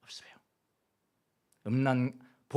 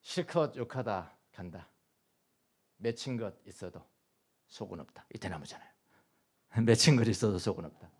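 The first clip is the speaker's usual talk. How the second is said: poem recital; 3 semitones lower than usual. Acted.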